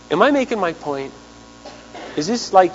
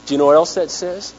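usual speaking voice

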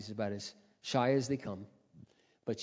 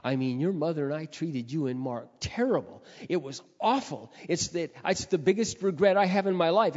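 builds from steady to louder and higher; remorseful confession; 4 semitones higher, 7 dB louder than usual. Retold or imitated. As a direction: let the regret swell until it breaks out loud. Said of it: "He said".

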